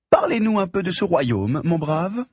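lofty high society lady voice; haughty aristocratic french. Acted. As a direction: condescend to the driver like nobility. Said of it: "en prenant une voix d'aristocrate".

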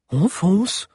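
anxious echo of the plan; worried. A narrator's reading. Minister asking the giant, worried. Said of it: "s'inquiéta Solario".

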